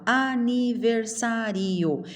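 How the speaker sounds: "aniversário" as a-ni-ver-sa-RI-o; split final vowels. Shown as a demonstration. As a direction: separate ri and o. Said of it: "Não, eu vou no".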